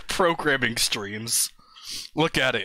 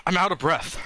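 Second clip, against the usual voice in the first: ordinary talk, nothing marked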